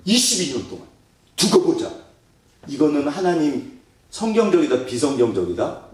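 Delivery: normal talking voice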